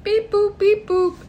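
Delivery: high beep boop noises; robot voice beeps. Pretend. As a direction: chirp it like a machine. Mock phone tones after telephone joke.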